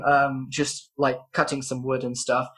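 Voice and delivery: usual speaking voice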